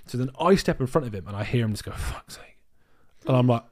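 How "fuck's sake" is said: breathy whispered annoyed curse. Retold or imitated. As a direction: hiss it under your breath. Thief's annoyed mutter retold.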